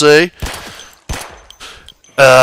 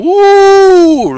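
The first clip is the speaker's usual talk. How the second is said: a high, louder ooh of delight; 5 dB louder than usual. Real, not acted. Gamer spotting a katana in the game, delighted high ooh. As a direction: gush over the shiny sword.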